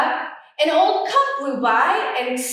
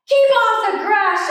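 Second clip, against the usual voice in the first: much higher, louder cranky shout; grumpy shout; 8 semitones higher, 5 dB louder than usual. Acted. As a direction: shout at the passing cowboy. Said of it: "shouted Hank".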